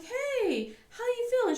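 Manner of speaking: acted sing-song check-in; breezy greeting. Mom checking on daughter downstairs, put-on breezy.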